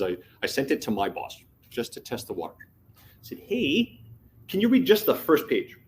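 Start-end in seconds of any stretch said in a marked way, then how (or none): 3.4-5.8 high, light asking voice; tentative request. Retold or imitated. Employee asking his boss to read one page, tentative request. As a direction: lift the pitch to ask a favour.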